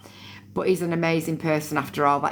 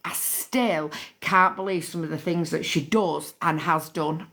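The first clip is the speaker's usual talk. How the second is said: ordinary delivery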